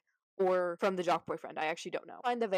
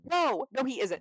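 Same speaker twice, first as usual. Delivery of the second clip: high, loud flat-out no; indignant no, pitched up and loud; emphatic denial; 5 semitones higher, 5 dB louder, slower than usual. Real, not acted. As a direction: shut the idea down hard, higher and louder. Rejecting the crowd's praise.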